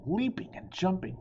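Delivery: plain reading voice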